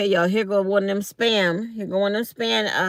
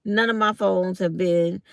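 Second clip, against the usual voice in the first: usual speaking voice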